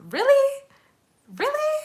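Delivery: high-pitched questioning "really?"; squeaked, doubtful surprise; surprised. Real, not acted. Reacting to podcast suggestion.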